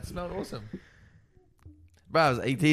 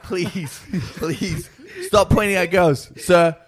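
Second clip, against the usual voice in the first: higher-pitched pleas, growing louder; mock pleading; 5 semitones higher, 5 dB louder, much slower than usual. Audience member to the man on stage, mock pleading. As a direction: beg a performer to stop, half laughing. Retold plea to stage performer.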